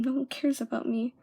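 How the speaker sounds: ordinary delivery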